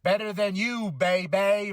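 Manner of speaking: mock announcer hype on "baby"; hyped intro of the team; announcer call. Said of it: "Or should I say".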